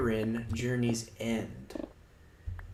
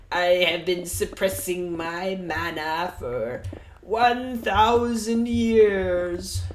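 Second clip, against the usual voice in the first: slow, much higher and much louder, swelling grandly; mock an anime hero revealing hidden power; hammed-up dramatic anime boast; 7 semitones higher, 7 dB louder, much slower than usual. Mocking an anime boast to stream viewers, slow, high and loud. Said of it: "'cause the character's like".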